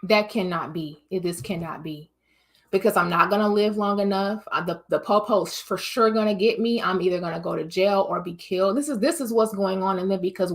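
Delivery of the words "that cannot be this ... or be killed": despairing man. Retold or imitated. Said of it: "when he sees that".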